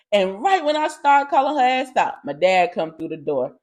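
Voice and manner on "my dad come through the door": usual speaking voice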